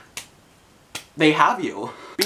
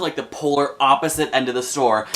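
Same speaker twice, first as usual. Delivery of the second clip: usual speaking voice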